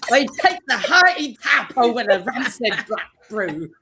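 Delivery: laughing through a mock pirate accent; amateur pirate voice, breaking into giggles. [name] answers the quiz in a laughing pirate accent. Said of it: "same question in a pirate accent".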